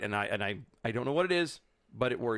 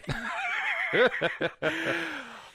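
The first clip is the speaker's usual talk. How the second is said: big laugh. Real, not acted. Laughing after pay joke.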